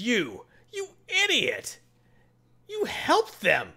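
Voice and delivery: high, slow drawn-out scolding; a hammed-up villain berating her; high, slow mock fury; mock villain rage. Mordo berates Red Cat.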